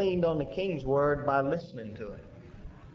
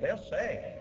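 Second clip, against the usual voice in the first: usual speaking voice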